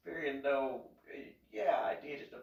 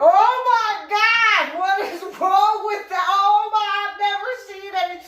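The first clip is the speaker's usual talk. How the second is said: shrieking in shock; 14 dB louder than usual. Retold or imitated. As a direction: imitate onlookers screaming in horror. Mimics men reacting to the burn.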